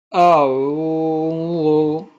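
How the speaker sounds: long drawn-out اعوذ in recitation style; recited slowly. Shown as a demonstration. Teacher demonstrating the word for students, slowly chanted. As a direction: stretch اعوذ slowly and melodically. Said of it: "شروع کرتے ہیں سورۃ الناس کو تجوید کے ساتھ".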